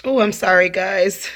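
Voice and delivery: sudden loud startled apology; jump, then apologize. Real, not acted. Startled by a bug.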